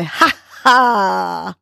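a lighter ha ha. Shown as a demonstration. Host showing the hands-out laugh, lighter ha ha. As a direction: drop to a small ha ha with hands out. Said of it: "and say".

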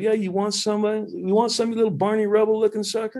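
high mocking voice, ribbing a teammate; imitating a teammate's high teasing jab; teasing trash talk. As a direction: needle him like the team joker. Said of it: "he's like".

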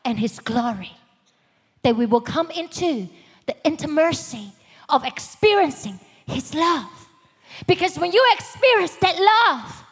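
rising fervent sermon voice; preaching at full force. Acted. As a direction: build it up and preach it out.

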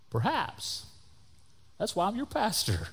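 high, soft, teasing lilt; playful aside. Real, not acted. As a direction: toss it out lightly in a high, soft voice. Joking aside to the congregation.

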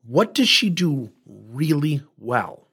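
slow, patient asking of "What does she do really well?"; slow, calm repeat. Retold or imitated. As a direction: draw each word out slowly, inviting honesty.

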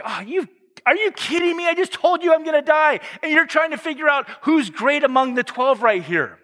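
high-pitched incredulous scolding; exasperated mock rebuke. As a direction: scold them in exaggerated, high-voiced disbelief. Imagined rebuke Jesus does not give.

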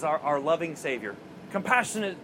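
normal talking voice

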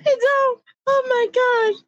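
high teary laugh; squealing through laughter. Real, not acted. Laughing fit returns.

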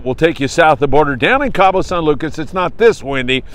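ordinary talk, nothing marked

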